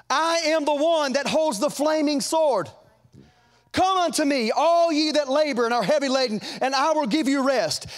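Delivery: high, loud preaching as Jesus; preaching at full force. Acted. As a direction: proclaim it like a revival preacher. Preacher voicing Jesus, rising.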